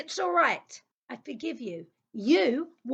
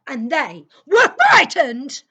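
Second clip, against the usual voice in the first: loud, laughing triumph; gleeful loud laugh; laughing shout; 9 dB louder than usual. Acted. Triumphant burst after whisper.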